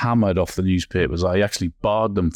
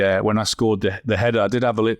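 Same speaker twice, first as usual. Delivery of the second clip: ordinary delivery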